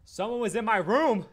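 shouting. Real, not acted. Yells reacting to game.

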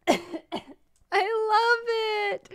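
high, a sob of joy in it; tearful delight. Real, not acted. Streamer thanking her friends, voice cracking with joy. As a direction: gush it through happy tears. Overwhelmed by gift.

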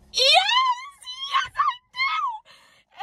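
very high, drawn-out squealed yes; hammed-up fangirl shriek; swooning squeal. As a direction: squeal it out, overcome with delight. Acts out eager swooning reply.

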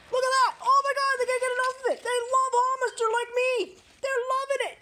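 high, gushing pet-owner delight; excited gushing. Real, not acted. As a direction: squeal it up as the dogs gobble it. Gushing over dogs eating hummus.

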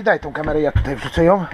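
normal talking voice

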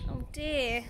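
normal talking voice

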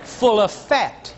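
slow, sly punchline; sarcastic drawl. Acted. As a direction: let each word drop slowly.

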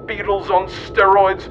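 ordinary talk, nothing marked

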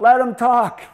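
high, loud call from the back of the class; yelled from the crowd. Retold or imitated. Classmate yelling out to the professor, loud and high. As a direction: shout it over the room so the student gets heard. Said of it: "somebody yells out".

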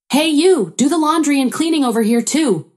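high-pitched bossy demand. A narrator's reading. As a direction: order the housekeeper around, pitch jumping up. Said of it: "my mother-in-law demanded".

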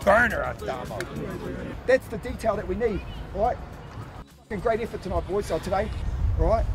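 ordinary delivery

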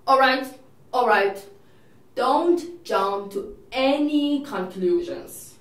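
ordinary talk, nothing marked

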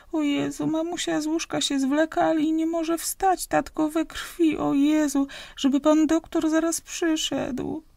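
put-on high, pleading child's voice, sobbing and begging for the doctor; a frightened little girl begs for help through tears. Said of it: "szlochanie dziecka".

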